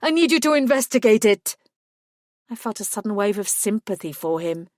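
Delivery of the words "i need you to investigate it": louder and higher, pressing hard; urgent plea. A narrator's reading. Werewolf pressing the detective to act, raised plea. Pleading werewolf continues outburst.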